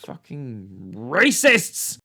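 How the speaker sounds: loud, slow, exaggerated outcry; hammed-up fury; mock outrage. Reviewer faking fury at the killer, loud and slow. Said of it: "outburst after kill".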